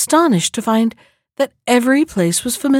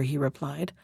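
plain reading voice

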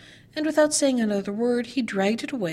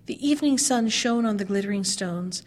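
plain reading voice

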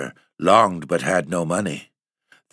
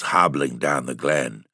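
ordinary delivery, nothing marked

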